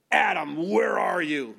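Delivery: loud, scolding demand; frustrated, terrifying tone. Shown as a demonstration. God confronts a disobedient Adam, loud and frustrated. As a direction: call out sternly to frighten the culprit. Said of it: "that terrifies you".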